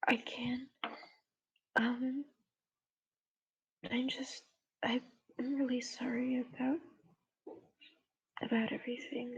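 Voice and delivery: soft unvoiced apology; whispered apology. [name] apologizing to Junie, whispering. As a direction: whisper it, sorry.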